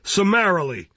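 summary judgment, voice lifted; peremptory magistrate. Acted. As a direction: declare it brusquely from the bench. Magistrate pronounces sentence.

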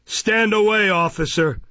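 loud, high order; Fang's cry. A narrator's reading. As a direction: call out a sharp command. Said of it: "cried Fang".

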